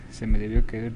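loud, rattled talk after a fright; shaken and panicky. Real, not acted. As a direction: fret loudly, too scared to move. Shaken after the jump scare.

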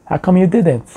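usual speaking voice

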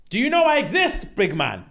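high-pitched outburst, imitating her; mock indignant confrontation. She confronts Duncan after his day out, mock indignant. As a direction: confront him as the ignored partner. Retold partner confronting absent man.

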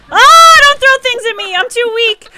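high, loud protest; playful panicked plea. Pretend. Weak player having things thrown at them, high panicky pleading. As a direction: cry out under fire, half in play.

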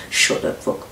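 whispered threat; threatening whisper. Retold or imitated. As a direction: hiss the threat under the breath. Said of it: "threatening like".

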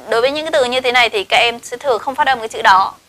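ordinary talk, nothing marked

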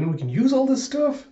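high, squeaky eager question; imitated childlike excitement; Chopper, excited.

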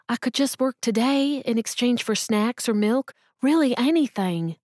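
pleading mother. Acted. Jobless mother begging the cashier for work, pleading. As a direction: beg the cashier for any work, lightly pleading.

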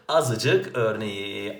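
Azıcık drawn out very slowly; slow example. Shown as a demonstration. Teacher naming an example word for students, very slow. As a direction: lay out azıcık one syllable at a time. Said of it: "teacher giving an example word".

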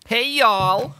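loud, high mock-Southern holler; mock Southern accent. British co-host echoing the American guest's greeting, exaggerated Southern accent. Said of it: "if we trade accents now".

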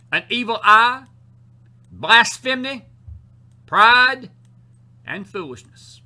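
loud, high, slow scripture proclamation; full-force preaching. Real, not acted. Preacher reciting what comes out of the heart, full-force preaching. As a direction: hammer out each sin, pausing between them.

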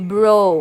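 "brew" said loudly as "bro", with a long O; long O vowel. Shown as a demonstration. Teacher models the vowel O for students, "brew" sounded as "bro". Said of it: "สระ O".

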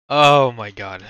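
ordinary talk

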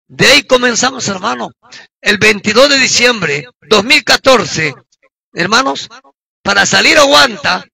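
usual speaking voice

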